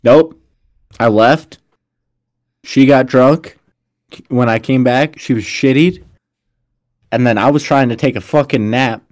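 ordinary delivery

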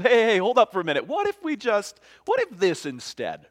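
high, loud, hasty objection; urgent interjection. Acted. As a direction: cut in and bargain, acted. Acts Moses talking back to God.

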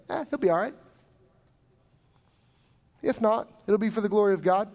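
breezy, fast brush-off of "it'll be for the glory of God"; mock-careless pastor, rushed; dismissive shrug. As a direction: toss it off casually like it doesn't matter. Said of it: "He's a jerk".

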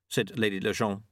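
ordinary delivery

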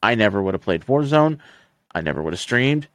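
ordinary talk, nothing marked

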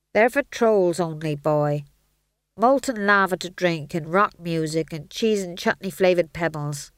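lightly voiced grumbling dwarf; a weary dwarf putting the boy off; gruff dwarf. A narrator's reading.

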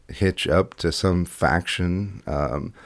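usual speaking voice

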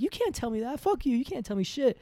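high-pitched, whiny imitation of protest; mock indignant sister. Sister defying her brother over the room, mock indignant.

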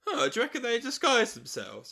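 high, loud, laughing mock Horace; a giggly imitation of Horace; laughing Horace quote.

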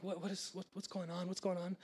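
very soft, puzzled asking under the breath; confused muttering. Retold or imitated. Retold confusion at a commotion.

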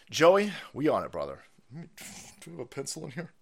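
normal talking voice

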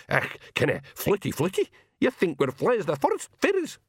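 high, scornful Scots pixie sneer; mocking high-pitched pixie brogue. A narrator's reading. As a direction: sneer it as a tiny, offended pixie. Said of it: "sneered a blue man".

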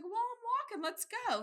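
put-on high, eager old lady; determined old mom. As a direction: set off brightly, no doubts. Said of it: "She's like".

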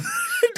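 squealing burst of laughter; high-pitched giggle. Real, not acted. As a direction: crack up mid-story. Laughing at own story.

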